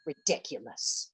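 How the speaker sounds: slow, whispered scoff under her breath; muttered aside. Acted. Woman muttering to herself after the call, a whispered scoff. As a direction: scoff to yourself, barely voiced.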